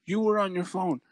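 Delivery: high, indignant mock yell; retold calling out. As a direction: accuse like an outraged driver. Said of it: "she fucking started calling her out".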